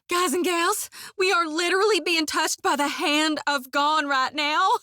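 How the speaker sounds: high, breathless wonder, a touch hushed; star-struck, can barely believe it; giddy awe. Acted. Priestess gushing to companions about her god, giddy awe.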